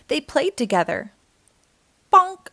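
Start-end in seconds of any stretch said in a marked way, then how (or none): 2.1-2.5 high, loud comic bonk sound. A narrator's reading. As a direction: pop it like a cartoon hit. Sound effect in story.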